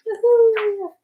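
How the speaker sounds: high whooping cheer. Real, not acted. Cheering viewers on to finish volume one, high whoop. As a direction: whoop to get the viewers excited.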